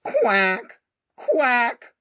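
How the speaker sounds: high, loud quacking; duck quack. Acted. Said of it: "called the duck".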